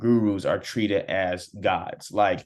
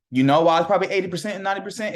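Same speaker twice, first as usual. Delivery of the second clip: high, fast and loud, driving the point home; emphatic; 9 semitones higher, 5 dB louder, much faster than usual. Real, not acted. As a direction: fire the question at them. Rhetorical challenge to viewers.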